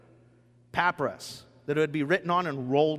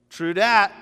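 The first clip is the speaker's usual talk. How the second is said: casual slang; 3 semitones higher, 8 dB louder than usual. Retold or imitated. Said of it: "gets up and goes".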